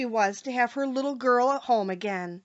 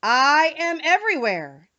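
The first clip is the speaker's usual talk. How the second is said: solemn Oz; 5 semitones higher, 6 dB louder than usual. A narrator's reading. Hidden wizard greeting the frightened travelers, solemn. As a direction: boom down from the dome. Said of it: "answered the voice".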